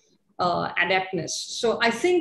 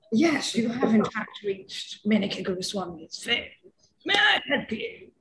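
mock British phone voice; British accent. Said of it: "so now I have a British voice".

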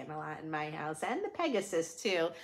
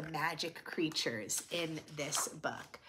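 whisper; 4 semitones lower, 7 dB quieter than usual. Real, not acted. Soft aside to viewers.

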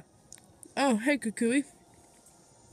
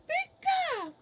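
Pikachu cry; 3 dB quieter than usual. Acted.

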